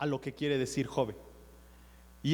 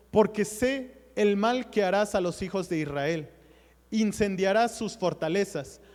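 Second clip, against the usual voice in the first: raised, louder reading of the passage; scripture reading; 3 semitones higher, 4 dB louder than usual. Acted. Preacher reading a Bible passage to the church, raised and louder. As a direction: read the verses out to the congregation.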